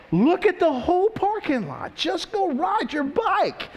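high, strained shout, slowing down; exasperated dad. Acted. Dad to daughter fearing the pole, exasperated shout. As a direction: play a dad at the end of his patience, calling out.

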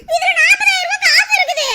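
high, loud, half-crying wail; tearful wail. Acted. Woman wailing to the robber over the money in her phone. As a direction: wail it out, close to tears.

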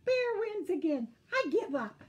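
high, loud, exasperated; defeated cry. A narrator's reading. Skunk surrenders to Bear, high loud cry. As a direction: wail it out in defeat. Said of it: "cried Skunk".

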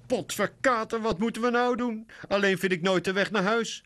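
crying. A narrator's reading. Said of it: "huilt Lucy".

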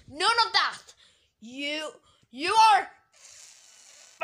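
high, loud scolding; angry shout. Pretend. Cutting off the customer's excuse, angry shout. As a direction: shout it down, stretching the last words.

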